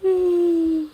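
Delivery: long weary sigh; tired sigh. Real, not acted. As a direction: let out a heavy sleepy sigh. Tired, yawning at night.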